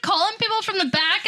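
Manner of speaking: ordinary talk, nothing marked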